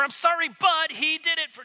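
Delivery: high, loud whiny sorry with a but; an apology that blames a sibling; excuse-making sorry. Shown as a demonstration.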